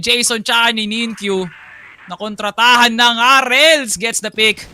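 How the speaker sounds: loud hype commentary, some words stretched; esports caster. Real, not acted. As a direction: shout the call over the crowd. Second caster calling the decisive fight.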